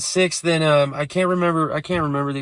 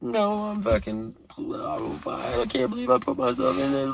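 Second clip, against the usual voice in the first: much higher, whining, softer falsetto; imitating whiny girlfriend; 10 semitones higher, 6 dB quieter than usual.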